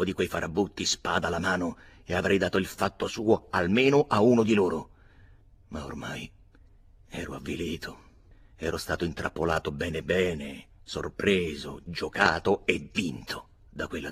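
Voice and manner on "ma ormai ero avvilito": sussurrato, sconfitto; avvilito. A narrator's reading. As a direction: quasi senza fiato, abbattuto. (sussurrando avvilito)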